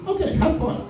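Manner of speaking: high mock-mom voice, waving it off; dismissive mom, pitched high; mocking mom's voice. Voicing mom in story.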